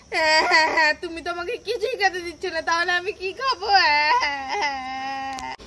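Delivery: hammed-up bawling complaint; mock tantrum sobbing; whiny crying. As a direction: wail like a thwarted child, drawing out the sobs. Child refused every snack, wails.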